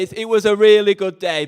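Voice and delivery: usual speaking voice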